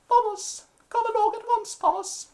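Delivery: very high, prim English lady calling; shrill posh mother summoning her son. Retold or imitated. English lady calling her son down the street, very high voice. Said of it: "some English lady going".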